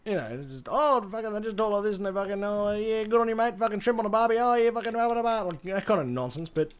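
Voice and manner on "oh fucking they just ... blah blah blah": higher, run-on mock Australian accent; exaggerated Australian. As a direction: ramble like a caricature Aussie. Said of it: "exaggeration of, like, "Oh, this is what an Australian is".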